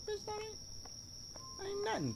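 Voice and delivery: high soft disbelieving squeak; incredulous scoff. Real, not acted. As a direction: scoff at tiny reward. Scoffing at reward.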